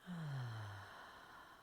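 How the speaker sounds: long breathy sigh out through the mouth; audible sigh. Shown as a demonstration. Teacher showing how to sigh out the exhale, soft sigh. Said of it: "Feeling free to sigh out the exhale".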